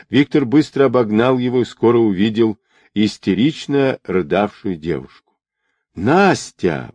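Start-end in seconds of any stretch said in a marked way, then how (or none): none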